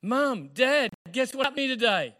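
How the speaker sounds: high, excited call to parents. Acted. Young woman bursting to tell her parents, excited calling out. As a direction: call out your big news in a high voice.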